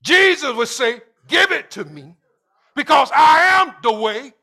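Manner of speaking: rising call and response fervor; building sermon preaching. Acted. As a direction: preach it harder with every line. Preaching at full force.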